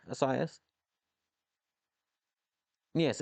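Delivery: ordinary delivery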